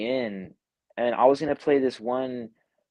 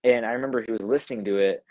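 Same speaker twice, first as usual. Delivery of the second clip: ordinary talk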